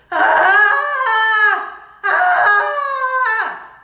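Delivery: high, held, very loud scream; stiff scream. Retold or imitated. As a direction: scream stiffly on one long note. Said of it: "like a stiff scream".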